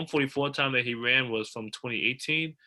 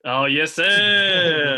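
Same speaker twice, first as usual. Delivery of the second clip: loud, higher, drawn-out cheer; hyped cheer; 4 semitones higher, 8 dB louder, much slower than usual. Real, not acted. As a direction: stretch it out like a proud fan. Answers shout-out to Iowa State.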